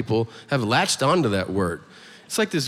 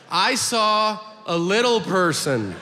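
slow, higher, stilted announcement; careful polite wording; 5 semitones higher, slower than usual. Acted. Forced to say it the new way, slow and careful. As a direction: pick each word slowly and carefully. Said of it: "Now I gotta be like".

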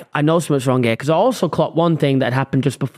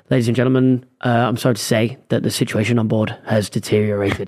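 lower-pitched, lightly imitated cabin announcement; grave announcer voice; 6 semitones lower than usual. Crew leader tells passengers the situation worsened, lightly imitated grave voice. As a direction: deliver bad news formally over the tannoy. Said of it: "with that voice and he goes".

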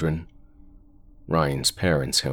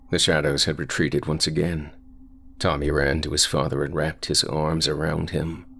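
ordinary delivery

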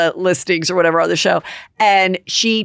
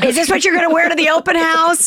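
mock catty realtor; 10 semitones higher than usual. Mocking the realtors' outfits.

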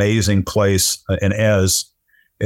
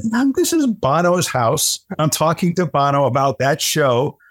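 higher and slow, retelling his own starstruck thought; awestruck inner voice; 6 semitones higher than usual. Retold or imitated. Guest at [name]'s house thinks to himself, higher and awestruck. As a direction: marvel at where you are, slowly.

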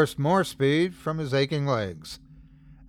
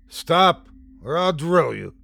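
sharp snarled threat; growled warning; 2 semitones higher, 3 dB louder than usual. A narrator's reading. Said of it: "snarled Kiefer".

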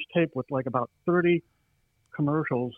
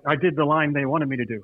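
ordinary talk

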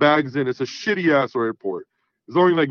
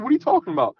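much higher-pitched mock disbelief; high-pitched retold bewilderment; imitated incredulous girlfriend; 11 semitones higher, much faster than usual. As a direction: imitate her protest in a high voice. Girlfriend protests during retold breakup.

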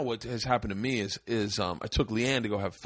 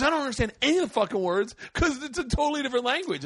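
fast, high-pitched mock rant; retold exasperation; 13 semitones higher, faster than usual. Friend answering comic's dumb question, retold exasperation. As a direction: rattle it off like a fed-up friend.

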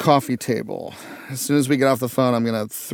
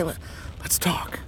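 whispering on the phone; 4 semitones higher, 7 dB quieter than usual. Acted.

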